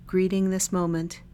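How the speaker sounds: calm body-scan guidance with long silences between phrases; unhurried, even meditation-guide voice; guided meditation. Real, not acted. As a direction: lead listeners gently through a meditation, leaving lots of space.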